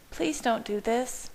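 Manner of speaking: high, pleading woman's voice; pleading groan. A narrator's reading. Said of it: "Bella groaned".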